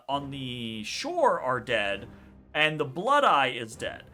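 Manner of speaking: usual speaking voice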